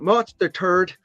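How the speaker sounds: lightly accented take on March the third, third said as "thuhd"; mock accent. Shown as a demonstration. Joking to viewers about an accent, light mock accent. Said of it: "if you have an accent".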